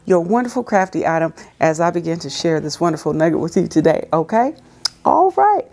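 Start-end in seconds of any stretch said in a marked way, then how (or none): 5.0-5.7 excited high call. Real, not acted. Sudden high exclamation.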